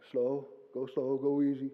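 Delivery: drawn-out slow go slow; slow and calm advice. Retold or imitated. Josh advising on the sheep, very slow. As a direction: ease them along, nice and slow. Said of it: "I remember Josh telling me".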